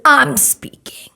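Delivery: slow, curt, cutting it off; stern interruption. Retold or imitated. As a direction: put her in her place, slowly. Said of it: "the lady's like".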